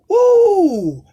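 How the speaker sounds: high, loud triumphant holler; excited whoop. Real, not acted. Celebrating his Bible point, loud whoop. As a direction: shout "woo" in triumph.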